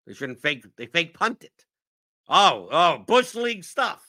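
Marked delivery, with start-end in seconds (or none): 2.3-4.0 high, loud scoffing mock; mock outrage. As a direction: ham up the outrage. Mocking complainers' outrage.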